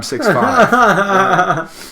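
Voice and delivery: high, slow, laughing delivery. Real, not acted. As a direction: laugh through the punchline. Joke answer, laughing.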